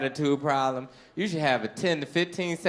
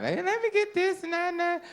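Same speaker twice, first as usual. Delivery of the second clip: very high, breezy woman ordering; high woman's voice. Acted. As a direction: order like the date, high and light.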